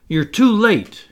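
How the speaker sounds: loud, high, drawn-out boast; triumphant, ringing announcement; proud cry. A narrator's reading. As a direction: call it out proudly, taking your time. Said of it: "he cried proudly".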